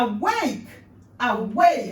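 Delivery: "awake" twice, loud and falling; emphatic demo. Shown as a demonstration. Teacher showing the phrasing, loud and emphatic. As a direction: call "awake, awake" with energy. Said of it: "how do you want to".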